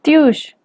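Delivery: "Tiush" pitched high. Shown as a demonstration. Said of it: "Todas estas palabras tienen tono alto".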